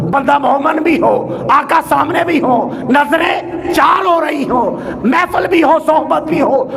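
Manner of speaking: high, insistent list, phrase by phrase; impassioned preaching. Real, not acted. Preacher picturing the believer before the Prophet, impassioned preaching.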